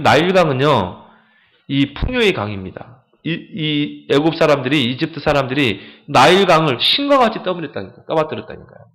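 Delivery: ordinary talk, nothing marked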